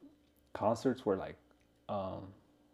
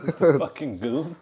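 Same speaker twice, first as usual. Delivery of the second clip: laughing it out, louder and higher; giggling through the punchline; 5 semitones higher, 8 dB louder than usual. Real, not acted. Laughs at his own joke.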